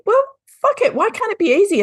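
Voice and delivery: high, exasperated retold outburst; exasperated cry. Retelling her own frustration to the host, high and exasperated. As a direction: throw up your hands in mock frustration.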